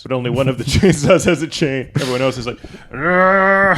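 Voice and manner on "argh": hammed-up pained scream, higher and louder; mock scream. Firing squad victim in a mock death scream. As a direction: yell like a man getting chainsawed.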